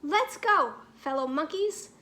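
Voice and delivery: ordinary delivery, nothing marked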